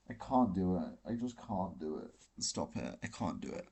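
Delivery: giving up, nearly silent; fading refusal. Real, not acted. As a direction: mutter it away to nothing. Refusing to talk about [name].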